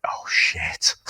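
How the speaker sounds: hushed mock whisper of realisation. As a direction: breathe it out as the penny drops. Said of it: "you're like".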